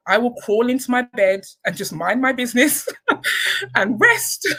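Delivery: laughing through the words, rising to a high giggle; real amused laughter at her own joke. Real, not acted. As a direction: joke about giving up while breaking into a laugh. Joking she'd hide in bed.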